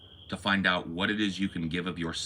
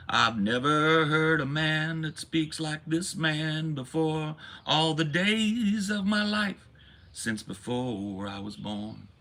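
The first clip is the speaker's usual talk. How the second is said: much higher, slow, swooning mock praise; rapturous churchgoer; 8 semitones higher, slower than usual. Congregant swooning over the new pastor, high, slow hammed-up rapture. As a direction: swoon over every word like a hammed-up believer. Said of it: "People would say".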